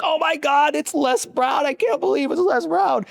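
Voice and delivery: very high, far-off squealing fan voice; fangirl squeal. Retold or imitated. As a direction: gush like a starstruck fan. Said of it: "I had, like, a major fangirl moment".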